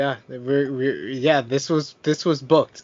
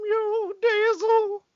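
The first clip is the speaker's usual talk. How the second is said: much higher sputtering foiled villain; Paul Bearer voice. Retold or imitated. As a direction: squeal the curse in a high wail. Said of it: "you gotta do the voice".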